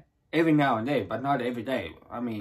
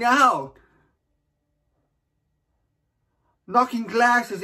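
higher, mounting stage delivery; dramatic monologue climax; 6 semitones higher, 3 dB louder than usual. Acted. Dreamer stumbling among party guests, dramatic monologue climax. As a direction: build the panic theatrically, stretching the last words. Monologue builds as things go wrong.

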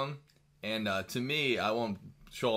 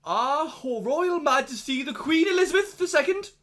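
much higher and louder, slow pompous British tone; hammed-up posh proclamation; mock royal herald; 7 dB louder, slower than usual. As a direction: announce Her Majesty like a grand herald.

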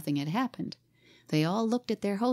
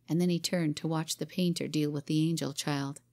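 ordinary delivery

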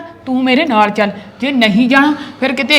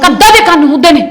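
sharp loud warning; 8 semitones higher, 9 dB louder than usual. Acted. Wife warns walls have ears.

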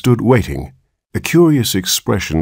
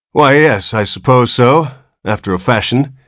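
grudging; 6 semitones higher, 4 dB louder than usual. Acted. Irritated doctor answers grudgingly.

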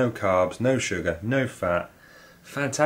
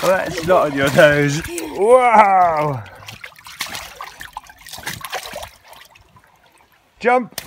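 high, loud excited cheering; excited calling out; 9 semitones higher, 7 dB louder, much slower than usual. Real, not acted. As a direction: cheer them on from afar.

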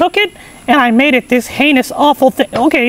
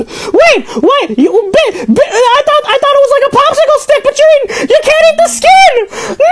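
high, frantic sputtering building to a scream; horrified protest; 11 semitones higher than usual. Real, not acted. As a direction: stammer in disbelief, then yell it out. Horrified at pineapple skin eaten.

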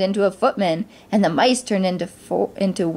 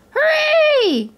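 very high squeaky cheer; cheering mice; 3 dB louder than usual. A narrator's reading. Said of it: "cried the mice".

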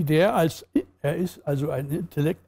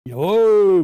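loud, higher, drawn-out shout; narrated roar; 4 semitones higher, 6 dB louder than usual. A narrator's reading. Audience answers the cabaret host, loud narrated roar. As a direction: roar it like an approving crowd. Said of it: "brüllten alle".

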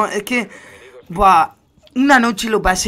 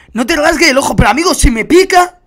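higher and louder, half-shouting in exasperation; exasperated outburst; 6 semitones higher, 7 dB louder than usual. Retold or imitated. Streamer retelling the eye itch to viewers, exasperated half-shout. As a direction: throw up your hands: what am I supposed to do. Exclaiming about the unbearable itch.

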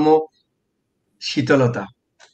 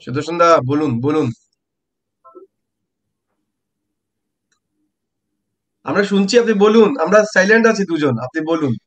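raised voice; 2 semitones higher than usual. Real, not acted. Urging guest on bad line.

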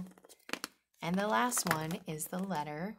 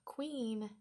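ordinary talk, nothing marked

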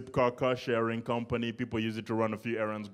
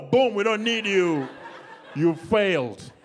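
louder, slow, gleeful; hammed-up cop passing sentence; slow, loud mock verdict; mock execution; 8 semitones higher, 5 dB louder, slower than usual.

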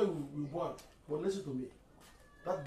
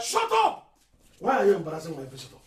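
sudden furious yell to silence him; angry shout; 3 semitones higher, 13 dB louder than usual. Acted. Father shouting down his son over the girl, furious. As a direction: bark it at your son.

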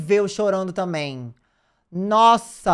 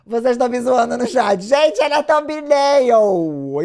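high, loud, slow protest; loud, stretched-out "gente" at the chat; indignant protest; 5 semitones higher, 7 dB louder, much slower than usual. Real, not acted. Streamer protesting to teasing chat, high loud indignant cry.

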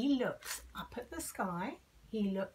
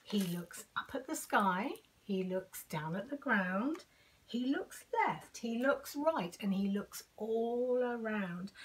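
ordinary delivery

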